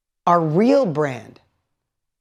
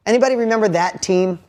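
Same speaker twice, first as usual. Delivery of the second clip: ordinary talk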